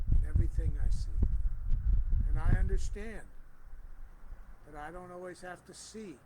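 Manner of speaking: rising, ringing affirmation sermon; fervent affirmative prayer voice; preaching at full force. Acted.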